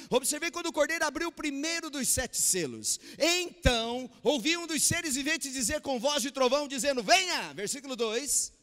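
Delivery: loud, high, ringing sermon voice; full-force preaching. Acted. Preacher rousing the congregation about the Lamb, at full force. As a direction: preach it out to the whole church.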